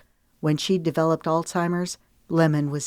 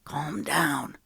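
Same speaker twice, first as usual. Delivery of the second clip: softer, hushed aside; whispered; 2 semitones lower, 5 dB quieter than usual. A narrator's reading. Knox quietly urging Lemon during the call, hushed. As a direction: hush her under your breath. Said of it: "Knox whispered".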